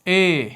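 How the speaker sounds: اے as a long mid ay; اے درمیانی آواز; in-between vowel demo. Shown as a demonstration. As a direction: hold اے between آ and ای. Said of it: "درمیان والی ایک آواز".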